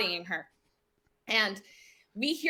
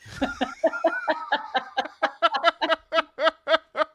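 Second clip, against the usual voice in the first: long hammed-up cackle in character; mock cackle; 3 semitones higher than usual. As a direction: let out a long crazed cackle. Woman cackles in his face.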